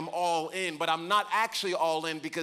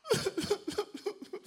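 mock child's sob. Child crying on the floor.

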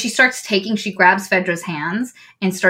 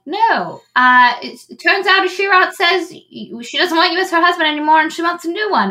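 mock decree; 8 semitones higher than usual. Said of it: "somebody was like".

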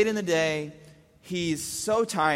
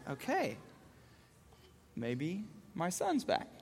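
soft, slow, tentative; hushed, hopeful retold thought; cautious relief; 12 dB quieter, slower than usual. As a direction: say it carefully, as if not to break the calm. Cautious relief as toddler calms.

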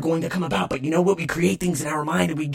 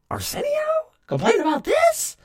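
high mock voice. High incredulous self-mock.

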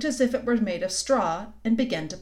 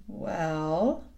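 drawn-out, hesitant, lower word; rather slowly; 4 semitones lower than usual. A narrator's reading. Lucy wants to be truthful yet kind, slow and hesitant. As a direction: stretch it out doubtfully. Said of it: "said Lucy rather slowly".

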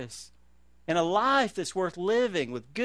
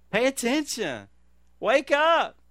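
higher, louder call to wake up; rousing shout; 7 semitones higher, 4 dB louder, slower than usual. Acted. Preacher rousing his sleepy congregation, shouting. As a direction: jolt the congregation awake with a shout.

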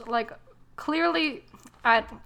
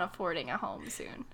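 whispered mock confession; voiced heroine, whispering the joke; 4 semitones lower, 11 dB quieter than usual. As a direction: hush it like a silly secret.

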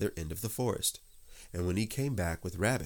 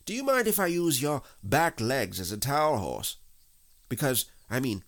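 higher, louder chummy rabbit voice; breezy Rabbit; 7 semitones higher, 5 dB louder than usual. Acted. Rabbit asks Pooh for his back legs, breezy. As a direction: chat on cheerily with lifted, swooping pitch.

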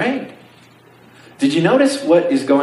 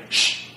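unvoiced "shh" to silence someone; hushing. Imagined Jesus hushing the crowd, acted shush. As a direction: shush them as if keeping a secret. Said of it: "Jesus isn't saying".

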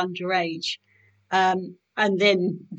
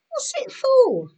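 high questioning; slower than usual. Acted. Soldier asks girl about feather.

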